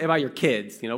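ordinary talk

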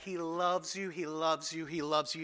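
softening each repeat, gently; tender repetition. Real, not acted. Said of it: "repeated tender assurance".

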